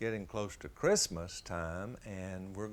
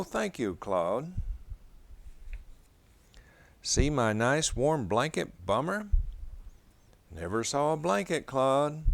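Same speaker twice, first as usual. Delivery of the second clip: higher, louder storybook dog voices; generous dog gives his gifts away; lightly voiced kindly dogs, gentle and sweet; dog dialogue read aloud; 4 semitones higher, 7 dB louder than usual.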